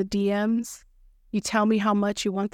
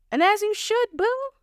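high sassy praise; sassy cheer; 11 semitones higher than usual. Acted. Hyping up her audience, squealing high and sassy. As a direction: gush it up high like a hype friend.

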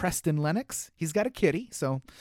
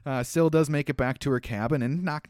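ordinary talk, nothing marked

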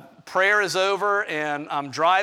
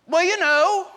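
high, loud, defensive stall; sheepish excuse; 11 semitones higher, 5 dB louder, slower than usual. Retold or imitated. Husband caught out by God, sheepish. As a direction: squirm out of it, pitched high.

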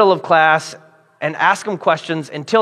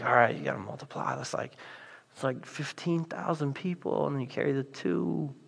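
much softer, quick mumbled counting; muttered math; 3 semitones lower, 16 dB quieter than usual. Retold or imitated. Philip counting the crowd to himself, muttered. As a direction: mutter the sums under your breath.

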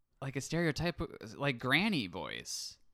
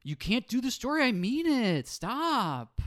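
much higher, insistent pleading voice; high-pitched protest; 9 semitones higher, 4 dB louder, slower than usual. Retold or imitated. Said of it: "he does, like, a, like".

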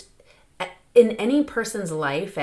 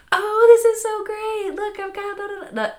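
fake overjoyed; 7 dB louder than usual. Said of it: "bump up to be like".

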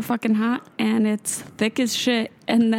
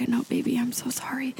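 unvoiced whisper, soothing the dog; whispered comfort; 2 semitones higher, 6 dB quieter than usual. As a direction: whisper sorry to her freezing dog. Comforting her dog again.

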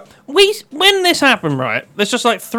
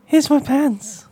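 put-on playful girl voice; 6 semitones higher, 4 dB quieter than usual. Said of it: "you be the girl".

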